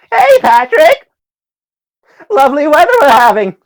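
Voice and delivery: very high, loud cartoon sponge squeal, rushed; SpongeBob voice. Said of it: "I'll try doing a SpongeBob voice".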